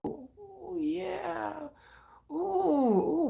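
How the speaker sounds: high, soft swooning coos; swooning coos at a photo. Retold or imitated. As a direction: coo over the photo. Ogling Calvin Klein ad.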